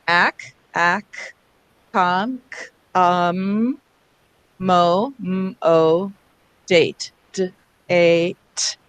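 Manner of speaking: "ac, com, mo, date" one chunk at a time, each split into its sounds; slow, separated phonics demonstration of "ac", "com", "mo", "date"; sounding out. Shown as a demonstration. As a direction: segment "date" into d, a, t with pauses between. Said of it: "to promote the orthographic mapping".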